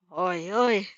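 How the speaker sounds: cold mocking call, lightly voiced; mock him coldly. Villain taunting the stalling Nomu, cold and mocking. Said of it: "sounds mocking and yet terribly cold".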